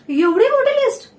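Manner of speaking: high, loud disbelief; astonished question. Acted. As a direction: balk at the size of it, pitch shooting up. Startled by a long shopping list. (आश्चर्याने उंच, मोठ्या आवाजात)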